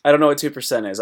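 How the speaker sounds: ordinary talk, nothing marked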